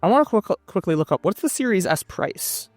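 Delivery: ordinary delivery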